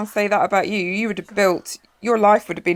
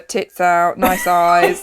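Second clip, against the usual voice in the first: usual speaking voice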